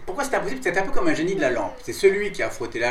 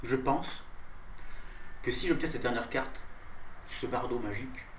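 soft, lowered, halting reveal; hushed suspense; 5 semitones lower, 11 dB quieter than usual. Acted. Goblin confiding his wish to adventurers, hushed and suspenseful. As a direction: confide it quietly, pausing between phrases.

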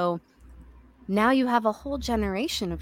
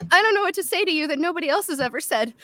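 nervous blurt; 9 semitones higher, faster than usual. Retold or imitated. Nervous fan meeting Carrie Fisher.

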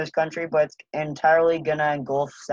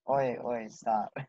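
mock shy denial; 4 semitones lower, 8 dB quieter, slower than usual. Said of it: "Oh, you're making me blush".

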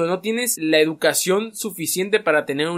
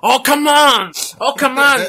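mock English exclamation; 8 semitones higher, 6 dB louder, slower than usual. Mock English phrase, high and loud.

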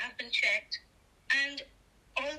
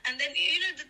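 ordinary talk

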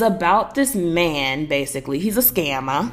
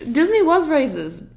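Disney stressed, said slow and higher; emphatic insistence; 8 semitones higher than usual. Real, not acted. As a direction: insist it, dropping the joke. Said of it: "I'm not kidding".

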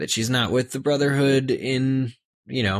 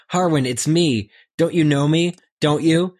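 higher, louder pleading call; pleading shout; 6 semitones higher, 4 dB louder than usual. Acted. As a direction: beg him to remember you. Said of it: "she shouted".

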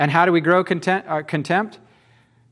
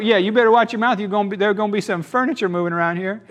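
mock tough-guy threat; 6 semitones higher, 3 dB louder, faster than usual. Acting out dudes' mock threat.

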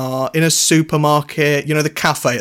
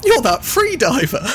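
put-on young woman; 9 semitones higher than usual. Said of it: "I'm a brunette lady in my early twenties".

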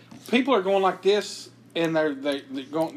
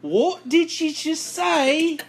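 high, drawn-out British challenge; put-on British indignation, very slow; mock British accent; 9 semitones higher, much slower than usual. Confronting a friend, high mock British accent. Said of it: "in my British accent".

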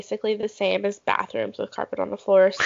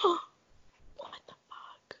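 gasped, breathless disbelief; shocked whisper; 7 semitones higher, 14 dB quieter, much slower than usual. Real, not acted. Gasps at pricey bar food.

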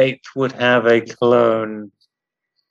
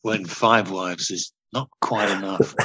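sultry perfume ad; 6 semitones lower, 6 dB quieter than usual. Pretend. Adding perfume ad tagline.

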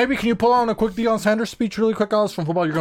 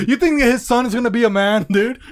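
ordinary delivery

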